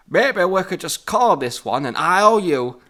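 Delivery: slow, loud Yorkshire drawl; Yorkshire accent. Acted. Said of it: "your Yorkshire accent".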